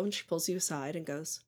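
ordinary delivery

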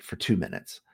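ordinary delivery

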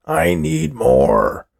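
high, slow, drawn-out wail of demand; screaming. A narrator's reading. Old man begging for another custard, screaming. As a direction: scream it, stretching each word. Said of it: "Old Man Finster screamed".

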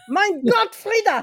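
very high, playful mock exclamation; hammed-up high-pitched outcry; mock alarm. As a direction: squeal it in exaggerated horror at the cat. Mock scolding the cat as Van Helsing.

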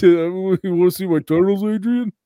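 high, loud slurred boxer; punch-drunk eagerness, words sliding together; Rocky. Acted. Boxer eagerly inviting his girl, high slurred impression. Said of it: "he was punch drunk before he fought in Rocky".

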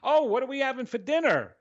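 high, quick, animated burst; imitating your own hyped-up self; high-energy excitement. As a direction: bubble over asking about dinner. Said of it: "in a very high energetic mode".

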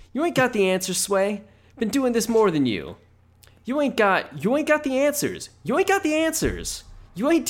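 very high, loud rapper rant, easing off toward the end. Retold or imitated. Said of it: "That's a good [name] impression".

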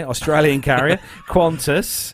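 bad put-on Australian accent, laughing; hammed-up Australian accent. Shown as a demonstration. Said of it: "the worst Australian accent I've ever heard in my life".

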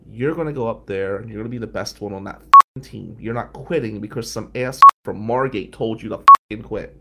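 loud, forceful lecture; stern scolding. Retold or imitated. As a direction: lay down the law. Tough teacher scolding student.

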